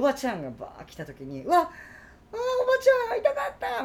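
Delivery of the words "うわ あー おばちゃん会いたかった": high squealing fan greeting; excited gushing. Retold or imitated. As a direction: gush like a fan meeting a star.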